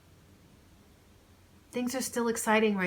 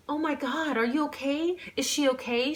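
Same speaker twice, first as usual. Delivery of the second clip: higher, anxious rush of concern; worried friend; 9 semitones higher, 4 dB louder than usual. Acted. Claire fussing over her injured friend, higher and worried. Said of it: "she asked".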